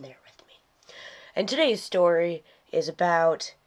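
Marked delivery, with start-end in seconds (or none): 0.0-0.5 whisper. Real, not acted. Sick host asks viewers' patience.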